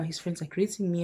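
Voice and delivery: ordinary talk, nothing marked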